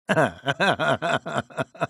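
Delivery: gloating villain's laugh; sinister chuckle. Acted. Scientist luring someone into his show, sinister chuckle. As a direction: let a sly cackle roll out. Scientist proposing a starring role.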